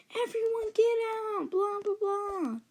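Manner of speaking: high-pitched imitation of a mom's order; mock shouting mom. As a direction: mimic mom's rant without real volume. Retold mom clearing the house.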